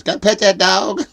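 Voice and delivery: a hint of the pleading kid from the video, rising on that; imitating the meme kid. Retelling the famous dog kid, mild high kid voice. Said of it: "she sounds just like the kid that says".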